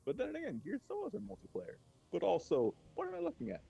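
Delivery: high, bewildered question; baffled. Real, not acted. Gamer baffled by the strange game, high and bewildered. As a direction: thrown by what's on screen.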